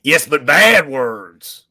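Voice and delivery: a scold stressing bad words; hammed-up angry villain; mock angry. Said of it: "angry voice".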